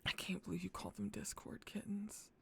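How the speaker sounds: hushed whisper. Real, not acted. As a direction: breathe it out in disbelief. Mortified at chat.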